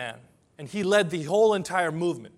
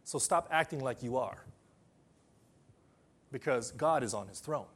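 calm reassurance; 3 semitones lower, 5 dB quieter than usual. Retold or imitated. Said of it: "Martin Luther was saying".

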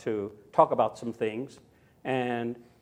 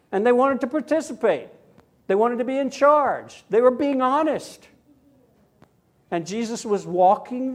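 raised, earnest sermon voice; preaching; 4 semitones higher, 4 dB louder than usual. Acted. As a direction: preach it to the congregation.

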